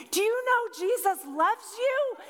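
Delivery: high, intense imitation of an earnest stranger; fervent believer. Stranger down in my face, fervently imploring. Woman gets in her face, asks.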